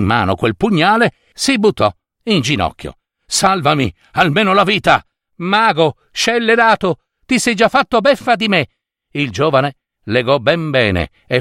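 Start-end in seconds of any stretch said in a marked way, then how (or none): 3.3-5.0 urgent, higher-pitched plea; pleading. A narrator's reading. Wizard on his knees before the youth, pleading. 5.4-8.7 high, scolding outburst; indignant accusation. A narrator's reading. Tricked young man rebukes the wicked wizard, indignant.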